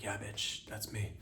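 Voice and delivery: winking back at her, soft and smug. Retold or imitated. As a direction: toss it off quietly with a wink. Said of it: "and I'm like".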